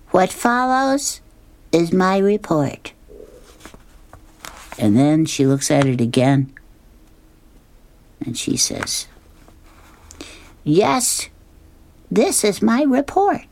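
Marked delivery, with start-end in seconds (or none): 0.1-2.9 put-on high child voice, pencil in mouth; pen in teeth. 10.6-13.5 put-on much higher, slow child voice; a proud little girl reading. Sally rereads her report.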